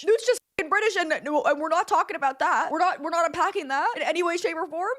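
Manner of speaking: high-pitched, worked-up brush-off; exasperated insistence. Real, not acted. Commenting on a randomly British character, high and exasperated. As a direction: push the pitch way up and refuse to discuss it. Repeats the absurd fact, refuses discussion.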